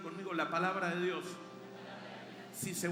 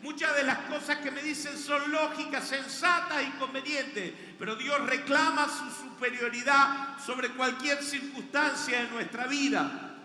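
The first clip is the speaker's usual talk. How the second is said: loud, raised, emphatic sermon voice; insistent proclamation with punched key words; full-force preaching; 5 semitones higher, 8 dB louder than usual. Real, not acted. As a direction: preach it hard to the whole congregation.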